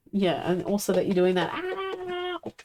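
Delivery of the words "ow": sharp yelp of pain; sudden high ow. Real, not acted. Pet hurts her.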